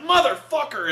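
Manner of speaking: high, loud mock action cry; heroine's battle curse. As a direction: shout it as the killing blow lands. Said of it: "where she's like".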